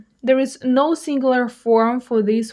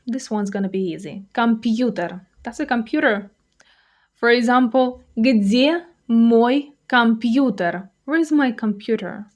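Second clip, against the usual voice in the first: ordinary talk, nothing marked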